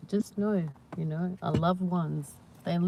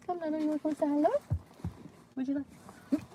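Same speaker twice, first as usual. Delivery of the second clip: high-pitched sweet talk to a pet; pet-coaxing voice; 11 semitones higher, faster than usual. Real, not acted. Owner asks pet to say hello, high coaxing voice. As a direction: coax the little dog over in a high voice.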